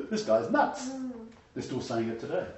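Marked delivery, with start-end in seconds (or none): 0.1-0.9 high-pitched imitation of appalled listeners; mock scandalised. Preacher voices shocked crowd reacting to Yeshua, mock scandalised. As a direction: pretend you can't believe what you heard. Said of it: "they would have gone".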